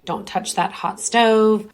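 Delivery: ordinary talk, nothing marked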